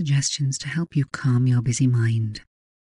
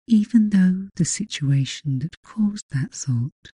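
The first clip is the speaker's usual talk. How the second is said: hypnotic voice. Acted. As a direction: drift each phrase out gently. Leads a hypnosis session.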